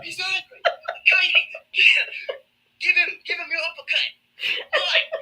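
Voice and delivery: high, laughing Australian wildlife host; hammed-up crikey showman; Aussie host. An Australian host urging Katie on in a high, laughing Aussie accent. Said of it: "Aussie host continues".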